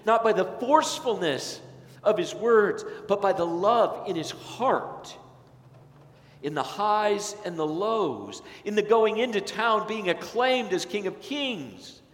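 climbing sermon cadence, slow and ringing; impassioned preaching. Acted. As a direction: preach it out to the whole congregation.

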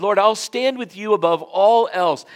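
loud, high, slow vow; Peter's ringing vow. Retold or imitated. Peter vowing to stand with Jesus, loud slow oath.

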